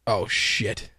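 low, soft startled curse under breath; muttered curse. Real, not acted. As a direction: mutter it as something breaks. Feed drops mid-show.

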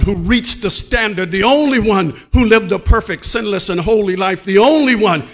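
high, loud shouted preaching; full-force preaching. Acted. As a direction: preach hard, hitting only every time.

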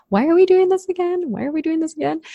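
high-pitched mock exasperated complaint; exasperated self-questioning. Host recalls moving-house roadblocks, mock exasperated. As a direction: imitate your own fed-up wail, pitched well up.

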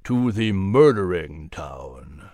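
low, slow, ominous; menacing low voice. A narrator's reading. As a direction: drop low and drag it out. Gilliman menaces Al.

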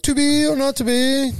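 high, sustained theatrical declamation; mock Shakespearean actor. Host pictures carrying a skull around, mock-theatrical high declamation. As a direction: intone it like a hammy stage Hamlet. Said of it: "carry a skull around and be like".

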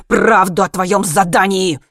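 loud, slow, forceful demand; furious demand. A narrator's reading. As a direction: press it out loudly, word by word. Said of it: "прошипел Хайман". (гневно, громко, с нажимом)

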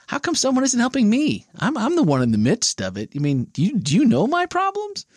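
high-pitched, put-upon protest; mock indignant complaint. Host voicing someone who wants help themselves, mock indignation. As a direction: play the self-pitying complainer, voice leaping up. Acting out excuses people make.

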